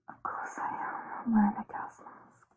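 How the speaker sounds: soft breathy whisper. Real, not acted. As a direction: whisper it close and intimate. Whispered through mask.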